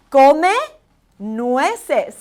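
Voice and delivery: deliberate teacher model; slow Spanish drill. Shown as a demonstration. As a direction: Come loud and rising, nueces falling. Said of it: "let's go over the word eat walnuts".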